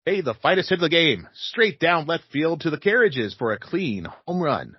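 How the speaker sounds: high, punchy vintage radio announcer; old-timey radio announcer. Pretend. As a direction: read it like a 1920s sportscaster. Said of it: "I'm gonna read this in my old, best old-timey radio announcer voice".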